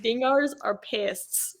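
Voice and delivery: slow, lightly Australian line; Aussie accent. Shown as a demonstration. Showing the host her best Aussie accent, slow and light. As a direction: try it lightly Australian, slowly. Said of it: "that's all I got is".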